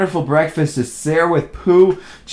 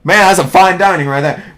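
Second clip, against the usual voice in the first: plain reading voice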